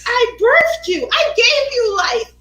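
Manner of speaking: very high, loud theatrical cry; hammed-up outrage at full volume; mock motherly guilt-trip. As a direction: holler it like a melodramatic wronged mother. Mock guilt-tripping her son.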